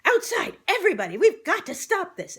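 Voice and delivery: high, loud rallying cry; urgent call. A narrator's reading. Jinx calling friends outside, high urgent shout. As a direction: hurry everyone out.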